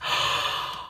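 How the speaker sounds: sharp, breathless gasp of surprise; startled gasp. Real, not acted. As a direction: gasp as a card jumps out. Card flies out while shuffling.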